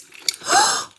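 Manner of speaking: a sudden high gasp of amazement; startled squeak of delight; sharp high gasp. Real, not acted. Amazed by the toy's detail.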